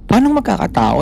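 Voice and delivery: a shade of startled disbelief; asking how anyone could still be inside; surprised disbelief. Retold or imitated. Butler surprised by the news.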